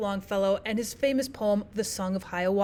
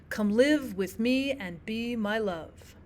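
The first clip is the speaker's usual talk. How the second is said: slow weak-strong beat: come LIVE with ME and BE my LOVE; measured stress on live, me, be, love; iambic reading; 4 dB quieter, slower than usual. Shown as a demonstration. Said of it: "writers that will use iambic feet".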